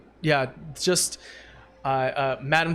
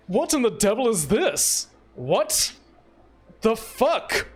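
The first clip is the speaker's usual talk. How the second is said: baffled homeowner finding a mess; acted bewilderment; 4 semitones higher than usual. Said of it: "you hear him go".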